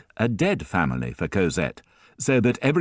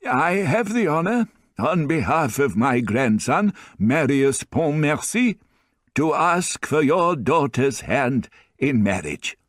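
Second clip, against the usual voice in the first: put-on higher, slow and ceremonious old man; the grandfather asking Jean Valjean for Cosette's hand, stately and slow; formal old grandfather; 8 semitones higher than usual. A narrator's reading. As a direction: make the proposal with stately pride.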